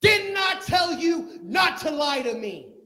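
high, loud, slow accusing shout; grieving mother screaming at the prophet; anguished shout. Acted.

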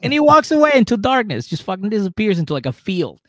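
loud high fast telling; excited storytelling. Retold or imitated. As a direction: build the drama. Dramatic storytelling climax.

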